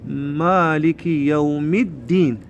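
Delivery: slow مالك يوم الدين, "yawmid-deen"; careful recited model, no L in الدين; doubled dal. Shown as a demonstration. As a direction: stretch it, leaning on the d of الدين. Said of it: "رأسًا لساننا يروح على حرف الدال المشدد".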